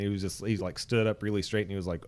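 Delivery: usual speaking voice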